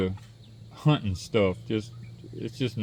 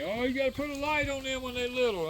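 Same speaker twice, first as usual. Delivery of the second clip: mocking advice voice; 13 semitones higher, 7 dB quieter than usual. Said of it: "everybody kept telling me".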